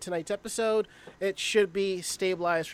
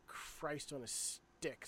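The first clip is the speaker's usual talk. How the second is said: slow, soft exasperated mutter; muttered under breath; 5 semitones lower, 18 dB quieter, much slower than usual. Real, not acted. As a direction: grumble it quietly to yourself. Exasperated muttering before the show.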